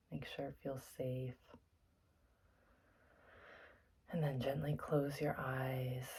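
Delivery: soft, unhurried meditation guide with long pauses; guided meditation. Acted. Teacher settling listeners into their seats for meditation, soft and calm. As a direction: gently talk them into stillness, leaving space between phrases.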